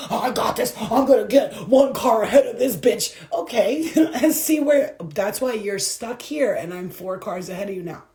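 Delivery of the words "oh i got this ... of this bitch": loud, cocky mock driver; impatient driver bragging about passing; smug driver. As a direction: boast while cutting ahead. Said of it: "people are gonna pass you".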